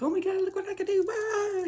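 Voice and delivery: very high, fast squealing glee; high-pitched excited shriek. Retold or imitated. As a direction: squeal it like a giddy kid. Acting out her excited self, squealing.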